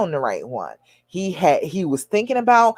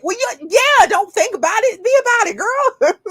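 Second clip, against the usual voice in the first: high, giggly egging-on; playful squealed cheer; laughing encouragement. Real, not acted. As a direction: urge her on through laughter, pitched way up. Joking with viewer while laughing.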